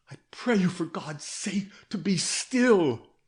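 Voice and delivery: frantic terrified begging; mortal anguish. A narrator's reading. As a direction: beg him to stop, near breaking. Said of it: "This was mortal anguish".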